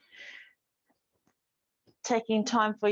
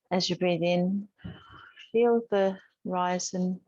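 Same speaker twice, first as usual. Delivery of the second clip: calm, unhurried instructor voice with long pauses; even, measured cues, leaving space to breathe; guided yoga meditation; 3 semitones lower than usual. Real, not acted. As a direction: gently guide a class through breath and movement. Leads breathing and gentle yoga.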